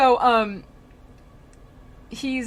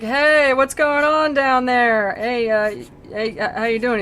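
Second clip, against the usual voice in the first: loud, raised comic hail; mock casual greeting, called out loudly; calling down; 6 semitones higher, 10 dB louder than usual. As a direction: holler down into a hole. Acted Dante calling down the hole.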